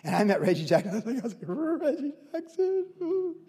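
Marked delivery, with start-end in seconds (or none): 1.5-3.3 high, soft, drawn-out awe; starstruck fan. Retold or imitated. Young fan meeting a baseball hero, high starstruck gushing. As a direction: swoon over the name in a high little voice.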